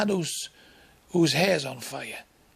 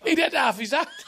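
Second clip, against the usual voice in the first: shouting through laughter; laughing; 12 semitones higher than usual. Real, not acted. Comic cracking up at his own story, laughing shout. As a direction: blurt it out cracking up.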